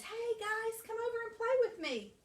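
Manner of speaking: high, bright call to friends. A narrator's reading. Scat calls out to friends.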